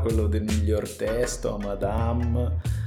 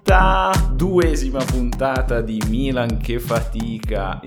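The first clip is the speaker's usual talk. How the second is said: louder announcer-style opening; podcast intro; 4 semitones higher, 6 dB louder than usual. Acted. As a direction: announce the episode with gusto.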